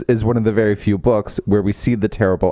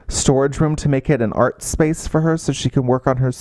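ordinary talk

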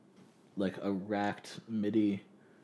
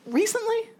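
very high mock mom question; 4 dB louder than usual. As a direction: squeak it like a doubtful mom. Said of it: "she was like".

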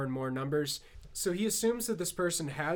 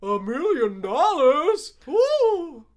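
high, slow, loud cheer; excited whoop; 10 semitones higher, 9 dB louder, much slower than usual. Retold or imitated. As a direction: yell it in shocked delight. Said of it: "bank teller is justifiably like".

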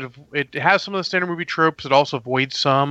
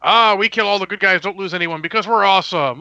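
higher-pitched mock boasting; mock cocky bad guys; 5 semitones higher than usual. Reviewer mocking movie bad guys who never lose, mock boastful. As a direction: imitate villains crowing over an easy win. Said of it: "the bad guys, you know".

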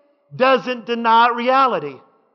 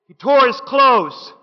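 ordinary talk, nothing marked

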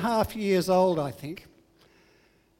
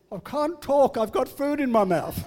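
put-on cheeky child; 5 semitones higher, 3 dB louder than usual. Child dodging with mouthful.

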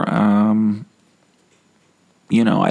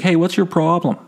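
confronting; 11 semitones higher than usual. Retold or imitated. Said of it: "I was like".

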